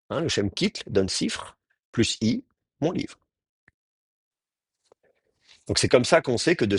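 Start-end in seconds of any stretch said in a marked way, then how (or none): none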